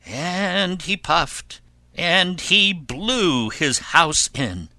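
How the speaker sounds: slow, high, drawn-out storytelling; dramatic narration. A narrator's reading. Narrator telling how the wolf blows, drawn-out drama. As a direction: stretch each action out for a child.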